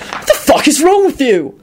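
high, exasperated outburst; demanding. A narrator's reading. Older sibling to boy with chainsaw, demanding angrily. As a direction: demand it in angry disbelief. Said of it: "Jamie demanded".

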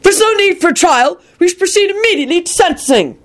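high, indignant heckler voice; angry holler. A narrator's reading. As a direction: demand punishment angrily from the back. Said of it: "hollered out angrily".

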